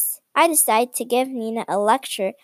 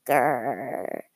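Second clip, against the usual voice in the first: deep mock growl; hammed-up storybook growl; low growl; 10 semitones lower, 5 dB quieter than usual. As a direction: growl low like an angry pirate. Pirate growls in comic.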